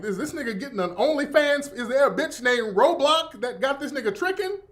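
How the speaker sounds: loud, high indignant yell; outraged. Retold or imitated. Uncle reading his bill, loud outraged yell. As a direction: demand to know who this is. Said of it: "I'm like".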